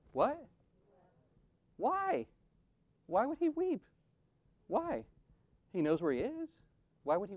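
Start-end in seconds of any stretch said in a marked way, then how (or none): 0.1-2.3 high, pleading questions to the mourners; rising rhetorical questions. Acted. Preacher's rhetorical eulogy questions. 3.1-3.8 "Why would he weep?" lifted high, stress on "he"; high pleading question. Acted. Repeated rhetorical question. 4.7-5.0 high, pleading questions to the mourners; rising rhetorical questions. Acted. Preacher's rhetorical eulogy questions.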